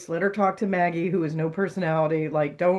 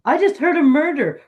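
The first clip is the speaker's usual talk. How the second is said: higher, louder urgent plea; frantic caller; 8 semitones higher, 6 dB louder than usual. Retold or imitated. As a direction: blurt it out as an alarmed caller.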